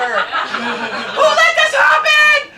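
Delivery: slow, high, loud demanding shout; outraged yell. Real, not acted. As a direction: demand someone fix this. Outraged rant climax.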